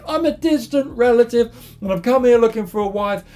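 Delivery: high, emotional acted gush; gushing suitor. Jacob explains himself to Rachel at the well, gushing.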